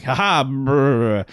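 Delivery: slow, drawn-out mocking laugh; slow smug taunt. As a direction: taunt them slowly with a smirk. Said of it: "And he's like".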